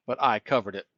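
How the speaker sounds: ordinary delivery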